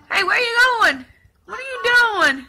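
high, loud teasing questions; playful challenge. Real, not acted. Mom to cheeky baby crawling off, playful challenge. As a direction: call out teasingly to a little one.

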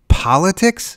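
high, loud cry of disbelief; outraged, rising yelp; incredulous scream. A narrator's reading. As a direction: scream the word as a question. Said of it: "Susan screamed".

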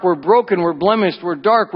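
ordinary delivery

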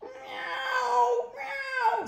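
very high, loud meows; piercing cartoon cat screams; screaming cats. A narrator's reading. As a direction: screech like panicked cats. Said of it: "screamed the cats".

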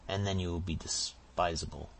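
ordinary delivery, nothing marked

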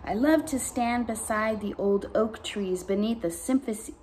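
plain reading voice

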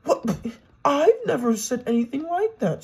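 a high, loud spluttered protest; spluttering. A narrator's reading. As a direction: protest in flustered shock. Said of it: "spluttered Daisy".

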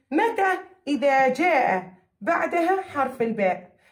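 usual speaking voice

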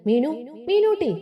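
calling out. A narrator's reading. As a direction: call her name to get her attention. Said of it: "തുളസി വിളിച്ചതിന്".

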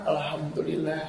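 put-on soft, frail reply of an elderly mother; old mother's voice. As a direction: answer quietly like an aging mom. Mother answers child in sermon story. (suara ibu tua yang pelan)

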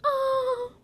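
high, drawn-out fake whimper; put-on sad moan; mock crying. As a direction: let out a high pretend-weepy "oh". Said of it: "But you're crying, and you're like".

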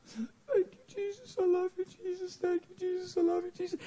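tiny high-pitched praise, softly repeated; imitating entranced woman. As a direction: mimic her dreamy prayer in a high, thin chant. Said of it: "laying there with her eyes closed".

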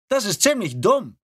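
high, dismissive verdict; scornful. Acted. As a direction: scoff at the idea. Rekrut urteilt abfällig. (abfällig)

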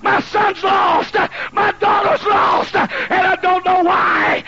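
high, slow shouted plea of a grieving parent; wailing parent. Retold or imitated. Parent begging the preacher for lost children, shouted wail. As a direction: cry out like a desperate mother at the altar.

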